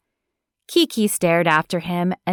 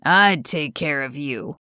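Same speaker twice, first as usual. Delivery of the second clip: lower, intimate pleading; 4 semitones lower than usual. Acted. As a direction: drop lower and plead personally. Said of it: "A pleading tone was thick in his voice".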